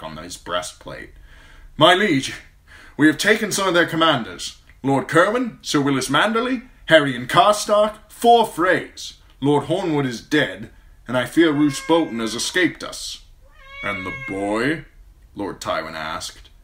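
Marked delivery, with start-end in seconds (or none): 1.8-13.2 loud, high, hurried messenger knight; urgent knight's report. A narrator's reading. Sir Adam reporting breathless and urgent. 13.8-14.8 cold, measured lord; calm, icy authority; Lord Tywin's voice. A narrator's reading.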